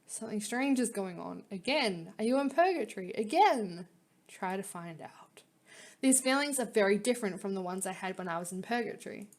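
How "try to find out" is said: whispering. Real, not acted. Aside during reading.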